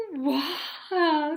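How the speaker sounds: a newborn dino's first wonder; baby dinosaur voice. A narrator's reading. The hatchling dinosaur greeting the world, awed.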